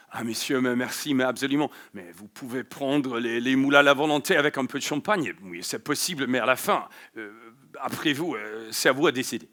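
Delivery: lightly hammed-up haughty French speech; a hint of a proud Parisian waiter; arrogant French. Shown as a demonstration. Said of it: "this shows a little bit in their attitude when they talk".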